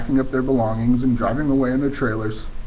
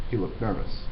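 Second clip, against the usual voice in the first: ordinary delivery, nothing marked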